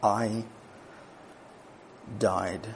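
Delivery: soft, low, slow; hushed repeat. Acted. Preacher echoing Jesus' death, hushed and low. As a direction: let it drop to a hush. Quiet echo after the loud take.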